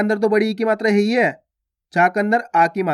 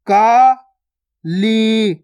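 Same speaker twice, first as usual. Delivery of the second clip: Kali split as kaa, pause, lee; syllable by syllable; much slower than usual. Shown as a demonstration. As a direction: slowly sound out Kali in two held syllables. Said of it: "direct मात्रा का उच्चारण करके पढ़ेंगे". (काली को तोड़कर, का... ली)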